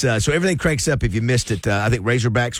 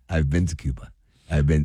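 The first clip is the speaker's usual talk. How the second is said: mock Cuban accent; 6 semitones lower than usual. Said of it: "you didn't do an accent this whole time".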